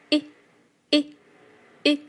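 一 as a very short, punchy "ih", each one separate; short i sound. Shown as a demonstration. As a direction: say each 一 short and quick, pushed from the belly. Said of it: "短促有力，收小腹，非常的快速".

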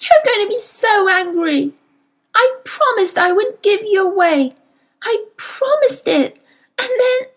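high-pitched tearful confession; sobbing. A narrator's reading. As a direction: sob out the guilt, voice climbing very high. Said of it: "she sobbed".

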